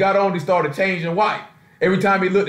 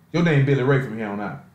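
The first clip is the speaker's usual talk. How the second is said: usual speaking voice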